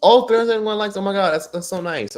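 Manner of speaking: high, loud burst of real joy; excited delight. Real, not acted. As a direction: exclaim it, thrilled by the likes. Delighted at rising number of likes.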